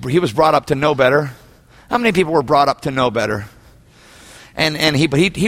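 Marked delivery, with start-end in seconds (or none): none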